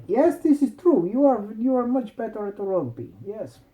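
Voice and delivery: high-pitched mock Eastern European accent. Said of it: "Daniela suddenly had an Eastern European accent".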